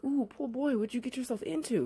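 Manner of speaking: high-pitched, cooing sympathy; playful high "oh, you poor thing" voice; mock pity. Mock pity for young suspect.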